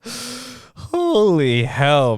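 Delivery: stretched slow amazement; slow drawn out. Real, not acted. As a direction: drag it out in disbelief. Drawn out after laughing.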